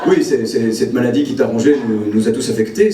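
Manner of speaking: low-pitched, halting funeral speech; mock solemn eulogy. Son-in-law speaks at mother-in-law's funeral, mock solemn. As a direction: feign grave sorrow in a low voice.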